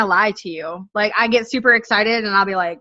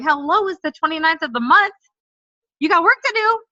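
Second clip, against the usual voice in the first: mock exasperated wake-up call; 7 semitones higher than usual. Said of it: "I'm like".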